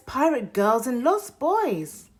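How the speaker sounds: usual speaking voice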